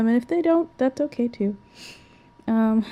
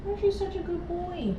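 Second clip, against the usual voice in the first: squeaky praising a dog; 11 semitones higher, 10 dB quieter than usual. Real, not acted. Baby talk praising the dog.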